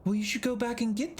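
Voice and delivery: high character voice. Acted. Pastiche character voice.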